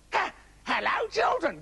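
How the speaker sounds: high, falsely sweet wolf greeting; pleased wolf doing a nice high voice; wolf's perfected nice voice. Acted. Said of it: "practiced speaking with a nice voice".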